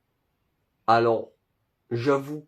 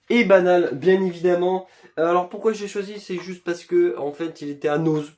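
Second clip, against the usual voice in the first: ordinary talk, nothing marked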